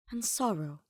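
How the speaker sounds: ordinary delivery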